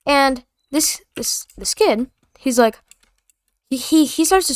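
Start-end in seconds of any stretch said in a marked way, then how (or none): none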